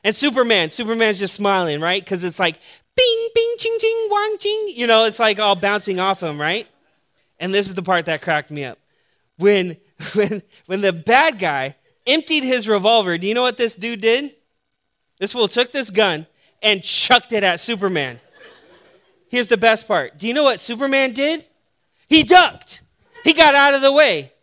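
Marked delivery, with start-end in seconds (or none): 3.0-4.7 very high squeaky ricochet noises; ricochet sounds. Retold or imitated. Preacher voicing bullets bouncing off Superman, high ricochets. 22.1-24.3 much higher, louder, delighted reveal; spring the punchline on them; excited punchline. Real, not acted. Preacher telling the church Superman ducked, gleeful and loud.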